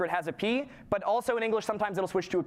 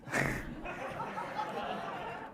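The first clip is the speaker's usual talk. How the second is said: laugh; 14 semitones higher, 8 dB quieter than usual. Real, not acted. Laughing at own slide.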